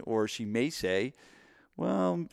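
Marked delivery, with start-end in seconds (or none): 1.8-2.3 high, hesitant skeptical opener; mock a doubtful customer hedging; doubtful, drawn-up "well".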